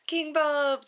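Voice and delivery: high, loud cheering; crowd cheer. Acted. Crowd cheering for their new leader. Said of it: "they cry".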